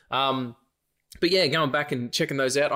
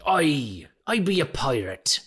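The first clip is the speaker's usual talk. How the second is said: hammed-up Irish pirate brogue; 4 semitones higher, slower than usual. His boxing shout as pirate Seamus, Irish brogue. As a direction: play a jolly pirate with an Irish lilt. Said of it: "by way of being a pirate named Seamus".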